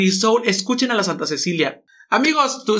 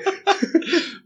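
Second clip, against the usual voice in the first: sudden high-pitched burst of laughter; 12 semitones higher than usual. Real, not acted. Co-host cracks up at the joke with a high laugh. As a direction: let a shrill squeal of a laugh escape. Hosts joking about pregnancy.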